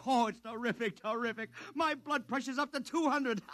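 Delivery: high, excited laughing brag; gleeful boast. Acted. Publicist bragging to his client, laughing with glee. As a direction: crow about it, laughing.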